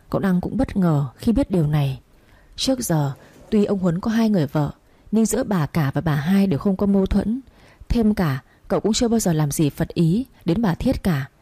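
usual speaking voice

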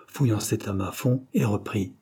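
plain reading voice

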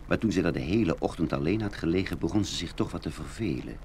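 ordinary talk, nothing marked

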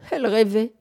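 usual speaking voice

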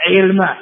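علم as 'ilma, kasra on the 'ayn; shifted vowel. Shown as a demonstration. Teacher listing three verb variants, علم said 'ilma. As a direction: say علم slowly as 'il-ma. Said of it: "فيه ثلاث لغات فقط".

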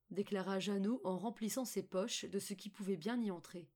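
usual speaking voice

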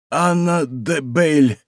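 slow, laboured utterance of a wounded man; умирающий. A narrator's reading. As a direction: draw the name out with effort. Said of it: "проговорил раненый". (медленно, из последних сил)

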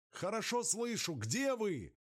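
shouting into radio. Acted.

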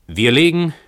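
calling down. Acted. Tenant calls down to the landlord, loud. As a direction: call it down the stairs. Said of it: "rief Heinrich hinab".

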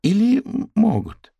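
high, loud, stammered realization; sudden doubt. A narrator's reading. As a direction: falter on the first sound, alarmed. Character has second thoughts, alarmed.

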